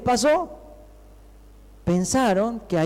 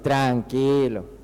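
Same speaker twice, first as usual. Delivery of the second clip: low, drawn-out soothing imitation; mock-calm, slow and low; teasing calm-down; 6 semitones lower, much slower than usual. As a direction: stretch it slowly, lowered, imitating a catchphrase. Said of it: "los muchachos y me dicen".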